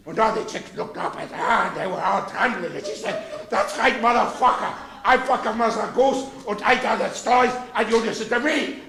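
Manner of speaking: bawdy nursery tale in mock German; put-on German-accented storyteller. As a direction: tell it with a hammed-up German accent. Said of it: "Tell the real story of Mother Goose in this accent".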